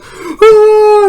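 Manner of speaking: very high, loud mock shriek; imitated terrified scream. Little guy screaming at the stabbing, high mock scream.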